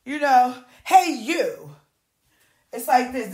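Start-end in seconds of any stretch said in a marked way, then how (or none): 0.8-1.7 loud, curt, high call; bossy set staffer. Retold or imitated. Mimicking a crew member bossing extras, loud. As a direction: snap like a rude crew boss. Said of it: "This how they treat you".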